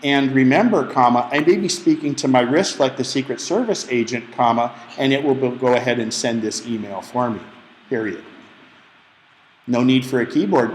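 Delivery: speaking punctuation aloud, deliberate; dictation voice. Shown as a demonstration. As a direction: dictate to the phone clearly, saying each comma. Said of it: "You must speak your punctuation".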